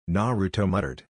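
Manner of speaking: usual speaking voice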